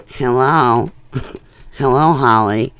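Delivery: loud, put-on operator voice; telephone operator. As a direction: call out like a phone operator. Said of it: "like, an A T and T operator".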